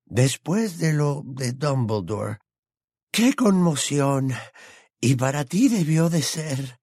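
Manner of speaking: put-on old wizard moved near to tears; hushed, emotional condolence. A narrator's reading. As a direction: draw the words out with feeling. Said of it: "añadió en voz baja".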